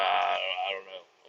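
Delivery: low, quiet mock-dumb I don't know; clueless mumble. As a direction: play a clueless guy shrugging it off. Imitating clueless coworker.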